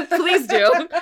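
bursting into a high laugh; real laughter through the words. Real, not acted. Joking about a name change.

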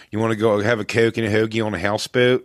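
put-on quick Philly accent with fronted O's; Ron Bennington. Guest quotes a stock line to show the O's, Philly accent. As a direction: exaggerate the Philly O's, briskly. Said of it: "Ron Bennington always used to go".

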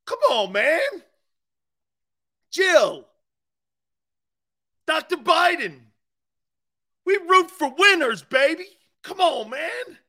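rally the room like a fired-up fan; excited shouting. Real, not acted. Host ranting at Doctor Biden about winners, high excited shouting.

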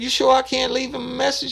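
high, put-on voice of the woman on the phone; old woman's voice. Woman answering a prank phone call, high put-on voice.

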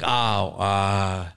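low, soft, hesitant mock excuse; imitated casual excuse. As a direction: play the friend offhandedly excusing himself. Retells friend's casual excuse.

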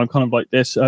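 normal talking voice

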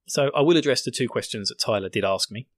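ordinary talk

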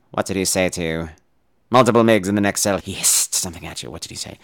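low, quiet, measured villain; Hannibal Lecter. Retold or imitated. Lecter questioning Clarice about the next cell, low and menacing. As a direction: probe softly in a very low voice. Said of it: "the Lecter's like".

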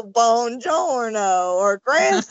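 drawn-out, badly faked Italian greeting; mock Brad Pitt's clumsy Italian, stretched slow; awful Italian accent. Host mimicking Brad Pitt's character speaking Italian, awful drawn-out Italian accent. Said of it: "with his awful Italian accent".

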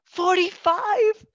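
high, loud, slow disbelief; incredulous echo. Retold or imitated. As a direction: echo the age in drawn-out, high-pitched astonishment. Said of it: "And I went".

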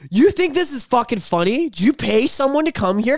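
high, loud accusing outburst; re-enacted furious accusation; retold screaming. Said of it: "I'm screaming".

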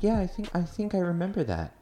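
a hint of a wary mom; mom on the phone. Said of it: "She's like".

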